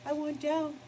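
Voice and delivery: high, put-on little dog voice; high squeaky dog line; voicing the dog. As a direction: speak for the pup. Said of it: "She's like".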